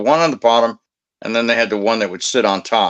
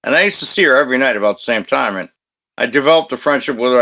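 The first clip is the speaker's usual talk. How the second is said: ordinary talk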